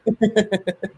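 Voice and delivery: a short high burst of laughter; laughing at the coffee joke. Real, not acted. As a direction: break into a quick laugh.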